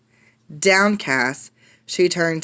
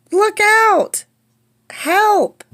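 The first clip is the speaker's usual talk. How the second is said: much higher, loud alarmed yelps; panicked cries; 5 dB louder, much slower than usual. Acted. Children crying out as a dinosaur wrecks the studio. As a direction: yelp in alarm.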